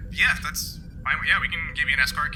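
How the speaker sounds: normal talking voice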